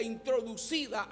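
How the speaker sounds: usual speaking voice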